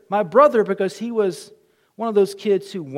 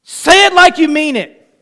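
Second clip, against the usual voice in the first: stern mom; 7 semitones higher, 14 dB louder than usual. Retold or imitated. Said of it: "mom demanding apology".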